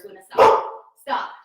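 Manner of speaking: loud, firm command to stop; sharp call to dog. Real, not acted. Trainer scolding her barking dog Luna, loud and firm. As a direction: snap at the dog to quit it.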